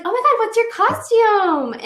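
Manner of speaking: put-on high, loud, ditzy teen girl voice; high-pitched excited girl asking; squealing party girl. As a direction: gush at her like a giddy sixteen-year-old. Imitating another little party girl.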